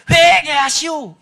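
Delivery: big, slow, high shout. Retold or imitated. Unseen voice calls a second time to the praying youth, shouting loudly. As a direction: boom it out, stretching the words. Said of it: "더 큰 목소리로".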